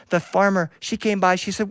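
ordinary delivery

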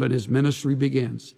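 normal talking voice

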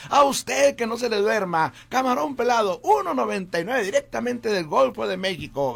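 high, loud radio ad pitch; ad announcer voice. Shown as a demonstration. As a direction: sell the chicken and shrimp like a hype announcer. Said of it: "Entonces ahí nacieron". (locutor de ofertas emocionado y fuerte)

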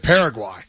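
"Paraguay" with a final eye sound; "-why" ending. Real, not acted. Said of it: "I always pronounce it".

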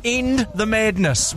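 normal talking voice

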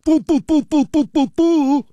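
high-pitched mocking dimwit voice. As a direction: mimic a fussing dimwit, pitch climbing into boo-boos. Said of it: "dimwit decides".

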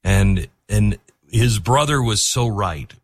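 usual speaking voice

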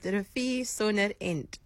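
"Rafie Sonderend" said slowly, the end clipped; correct pronunciation. Shown as a demonstration. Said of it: "That's how you can remember to say it".

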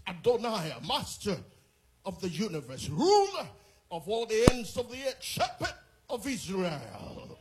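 building fervent prayer, rising to loud, high shouts; rousing prayer from calm to full-throated praise; preaching prayer. Acted. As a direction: pray with mounting fire until it rings out.

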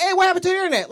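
put-on high, loud whiny kid; kid asking. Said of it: "They be like".

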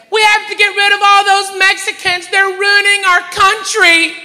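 high, loud mock tirade; angry outburst. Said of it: "outbursts like that".

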